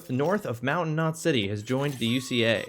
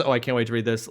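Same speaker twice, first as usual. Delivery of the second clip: ordinary delivery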